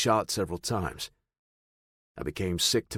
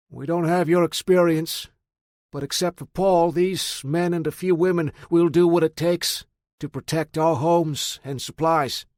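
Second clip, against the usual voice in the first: put-on higher, louder earnest older man; earnest Greg; 8 semitones higher, 5 dB louder than usual. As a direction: speak up firmly for the group. Greg speaks to the narrator.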